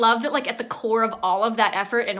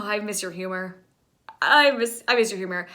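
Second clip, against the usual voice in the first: usual speaking voice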